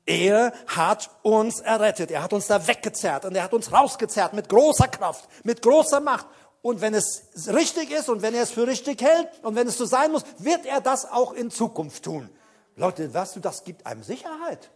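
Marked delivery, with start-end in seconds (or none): none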